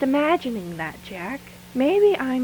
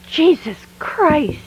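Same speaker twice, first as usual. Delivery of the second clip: louder, higher, slow shocked cry; startled exclamation; 6 semitones higher, 7 dB louder, slower than usual. Acted. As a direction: jump out of your skin and blurt it. Startled by Gordon appearing.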